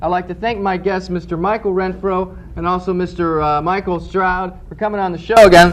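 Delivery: cheesy talk show host closing the show; a hammed-up TV host's goodbye; host sign-off. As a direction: announce it to the audience.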